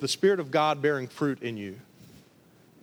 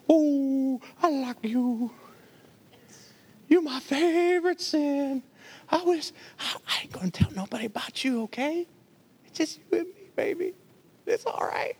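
seductive sweet talk; 10 semitones higher than usual. Retold or imitated. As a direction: coo to your pet sin like a sweetheart. Preacher voicing a sin's seduction.

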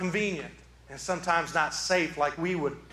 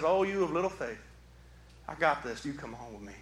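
soft, kindly, dropping lower; gentle reassurance; 4 semitones lower, 5 dB quieter than usual. Acted. Jesus to a frightened follower, gentle and unmocking. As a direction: reassure tenderly, trailing down quietly. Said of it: "I don't think he makes fun of me".

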